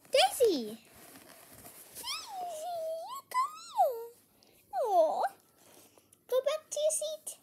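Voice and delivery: high squeaky dog talk; squeaky praising a dog. Real, not acted. Talking to dog.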